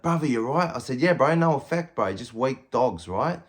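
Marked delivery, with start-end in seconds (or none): 0.0-0.7 high, loud shout across the yard; yelled call. Retold or imitated. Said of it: "yells out from that side too". 1.0-3.1 high, loud defiant call, then slow; shouted reply. Retold or imitated. Shouting back to friend across yard.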